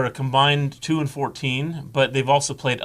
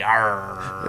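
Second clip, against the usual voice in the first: low, gruff pirate "Arr"; pirate growl; 6 semitones lower, 4 dB quieter than usual. Pretend. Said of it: "Talk Like a Pirate Day".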